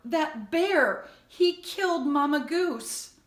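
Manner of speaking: high, loud tearful telling; crying boy; sobbing. A narrator's reading. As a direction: sob the words out. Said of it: "Jonathan was sobbing now".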